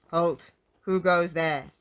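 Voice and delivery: loud challenge at normal pitch; sentry's cry. A narrator's reading. Goblin sentry at the gate, crying a challenge. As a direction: challenge intruders loudly as a sentry. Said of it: "cried the goblin sentry".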